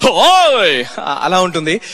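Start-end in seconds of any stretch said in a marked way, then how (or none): 0.1-0.8 sharp, higher call in the dubbing voice; Sai Kumar dubbed voice. Shown as a demonstration. Dubbing artist's voice for Dr. Rajasekhar, a sharp higher call. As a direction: bark it out like the dubbed hero. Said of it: "ఆ voice ఎలా ఉంటుందంటే".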